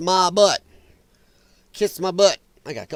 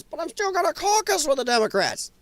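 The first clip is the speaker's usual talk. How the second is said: mocking her; 8 semitones higher, 4 dB quieter than usual. Mocking the politician's excuse.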